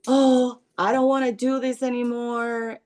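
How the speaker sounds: put-on teen groaning she won't do this anymore; imitating frustrated teen. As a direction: play the fed-up daughter, a bit hammed-up. Mother voices angry teen daughter.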